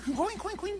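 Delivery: high little squeaky piglet grunts; piglet oinks. Retold or imitated. Preacher imitating José's baby piglet, squeaky oinks. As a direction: squeak like a tiny pig.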